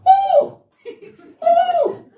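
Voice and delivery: high, loud mock gasp of surprise; a hammed-up, yelped oh; mock shocked cry. Preacher mocks fans in the front seats, surprised yelp.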